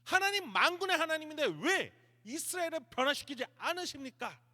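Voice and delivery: high, loud indignant demand; fed up, heated. Acted. Elijah, thoroughly heated, demands why of God, angry challenge. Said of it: "엘리야가 열받을 대로 열받은 거예요".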